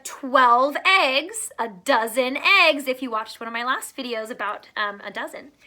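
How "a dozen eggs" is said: ordinary delivery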